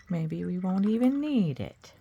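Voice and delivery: ordinary talk, nothing marked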